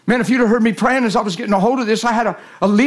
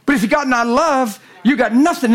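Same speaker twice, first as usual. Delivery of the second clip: higher, insistent verse; emphatic preaching; 4 semitones higher than usual. Real, not acted. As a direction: drive the verse home to the congregation.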